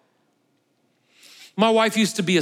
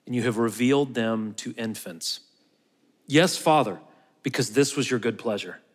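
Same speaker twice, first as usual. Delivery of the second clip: scripture reading; 6 semitones lower, 3 dB quieter than usual. Acted. Preacher reading Jesus' words to the congregation, hushed and reverent. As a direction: read the Gospel words softly and reverently. Reads the Gospel passage aloud.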